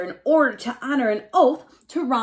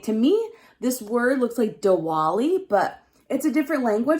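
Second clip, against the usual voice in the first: usual speaking voice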